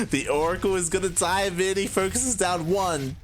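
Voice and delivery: high-pitched, laughing commentary; laugh, then carry on high and giddy; amused laughing. Real, not acted. Caster to viewers as the Oracle dives in, laughing. Laughs at the missing Spore, keeps casting.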